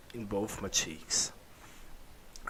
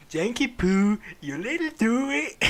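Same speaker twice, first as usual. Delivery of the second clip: high, louder hammed-up dad voice, giggling; high mock father voice; 11 semitones higher, 8 dB louder than usual. Father character teasing his son, in a hammed-up high voice. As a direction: ham up the father calling the narrator. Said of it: "Hawk Daddy said quietly".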